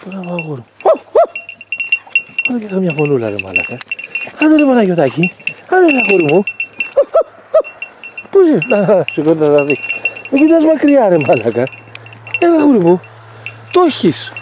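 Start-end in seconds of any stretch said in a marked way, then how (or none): 0.8-1.2 high hooting "χω χω" call to the bird; coaxing crow call. Real, not acted. To the rooster, urging it to crow, high hooting call. 10.3-14.3 higher, urging encouragement; eager coaxing. Real, not acted. To Τάκης the bird, urging him on, higher eager coaxing.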